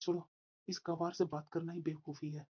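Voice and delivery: very low, soft mutter; scornful aside. Acted. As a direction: mutter it dismissively to yourself.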